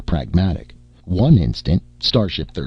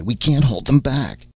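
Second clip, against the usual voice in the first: higher, hopeless cry; moaning despair; 7 semitones higher than usual. Acted. Brunei moaning to the crew, higher and desperate. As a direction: sound like you are losing the fight. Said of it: "moaned Brunei".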